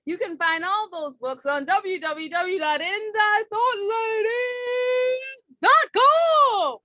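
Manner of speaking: slow, drawn-out car dealer pitch, big finish; car salesman voice. Shown as a demonstration. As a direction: sell it like a TV car salesman, stretch the end. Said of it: "I'm pulling out the car salesman voice".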